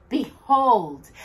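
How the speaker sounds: ordinary delivery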